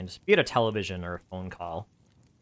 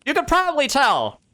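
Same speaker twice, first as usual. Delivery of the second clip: knowing excited aside; 9 dB louder than usual. Real, not acted.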